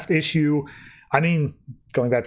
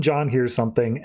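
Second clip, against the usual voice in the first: ordinary talk, nothing marked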